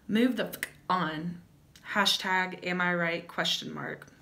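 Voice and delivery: ordinary delivery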